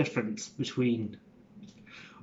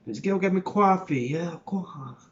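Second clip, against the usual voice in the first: Italian-American New York voice ordering coffee; broad New York Italian accent on coffee; 3 semitones higher than usual. Shown as a demonstration. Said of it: "Italian New York?".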